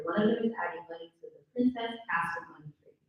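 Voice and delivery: ordinary talk, nothing marked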